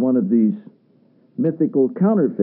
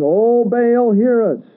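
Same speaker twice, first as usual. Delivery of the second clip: high, drawn-out plea, calling upward; slow, lifted cry of the pagan prophets; pleading cry to Baal; 9 semitones higher, 4 dB louder, slower than usual. A narrator's reading. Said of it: "called on the name of Baal".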